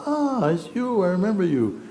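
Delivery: delighted recognition. Retold or imitated. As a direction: play a woman thrilled to spot a familiar face. Woman in car recognizes him.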